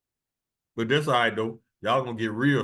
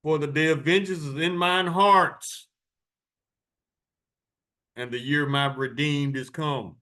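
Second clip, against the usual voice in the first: higher, declaiming verse; scripture reading; 4 semitones higher than usual. Acted. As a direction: proclaim the verse.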